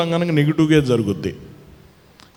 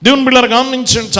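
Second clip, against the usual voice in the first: loud, ringing preacher's call, slowing on the last words; preaching at full force; 10 semitones higher, 7 dB louder, faster than usual. Acted. Preacher calling out loudly to the congregation about the sick woman.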